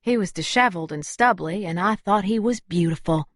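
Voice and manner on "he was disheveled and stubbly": ordinary delivery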